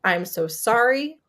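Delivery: normal talking voice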